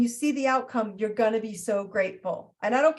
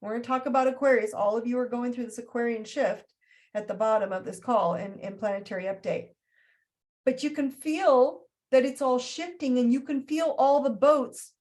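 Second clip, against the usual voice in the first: normal talking voice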